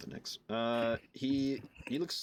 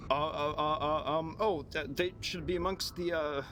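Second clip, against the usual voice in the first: higher, halting stammer of a rattled survivor; flustered Zeb, stammering; 5 semitones higher than usual. Acted. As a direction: stumble over the words, searching for an answer. Game master voices flustered Zeb.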